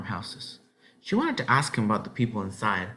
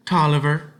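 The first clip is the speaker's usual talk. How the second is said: put-on high, louder child's answer; girl's voice; 6 semitones higher, 7 dB louder than usual. A narrator's reading. Ellen telling the farmer her name, high child voice. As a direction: state the name firmly, as a boy.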